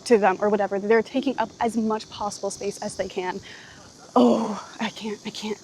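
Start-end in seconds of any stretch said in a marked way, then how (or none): 4.2-5.6 breathless whisper, giving up; exhausted whisper. Real, not acted. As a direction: barely voice I can't, worn out.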